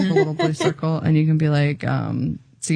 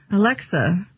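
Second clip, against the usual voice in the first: higher-pitched voice-assistant command; commanding Alexa; 4 semitones higher than usual. Shown as a demonstration. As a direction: call out an instruction to Alexa. Acting out a voice command.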